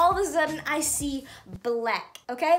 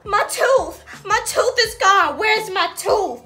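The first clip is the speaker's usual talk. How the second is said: louder, half-laughing wail over a lost tooth; retold crying; 5 semitones higher, 8 dB louder, slower than usual. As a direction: replay the wailing kid, loud and tearful. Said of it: "You best believe my butt was crying".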